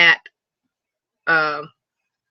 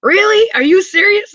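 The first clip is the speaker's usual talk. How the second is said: high, loud burst of re-enacted delight; excited; 14 semitones higher, 7 dB louder than usual. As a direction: act out thrilled disbelief, pitch way up. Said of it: "I get excited about simple little stuff".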